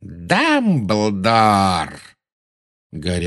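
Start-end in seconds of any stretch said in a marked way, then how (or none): 0.0-2.0 long drawn-out name, falling; involuntary exclamation. A narrator's reading. Villain suddenly seeing his great enemy, blurting. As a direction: let the name burst out and stretch. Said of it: "И тут у него вырвалось".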